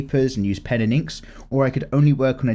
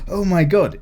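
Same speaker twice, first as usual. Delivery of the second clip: mock astonishment; 8 semitones higher, 4 dB louder than usual. Mock gasp at early comment.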